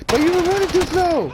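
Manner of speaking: high-pitched defensive comeback; acted protest. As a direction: throw the blame back in a high voice. Defends himself to friend, retold.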